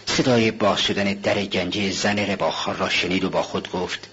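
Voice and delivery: ordinary delivery, nothing marked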